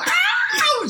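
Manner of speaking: piercing, very high yelp of pain; imitated pained scream. Imitating a coworker hit by cooler doors, high scream. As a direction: scream out in mock agony.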